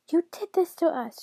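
high, loud accusation; furious cry. A narrator's reading. Enraged daughter blaming her father, high loud cry. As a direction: cry out in fury, pitch right up. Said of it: "she cried".

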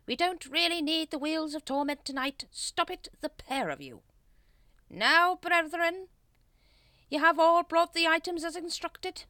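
high, loud, pompous cult leader; scold, then intone orders from on high; officious high-pitched leader voice; exasperated Grand Master. A narrator's reading. Grand Master scolds, resumes ritual.

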